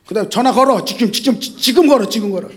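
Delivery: ordinary delivery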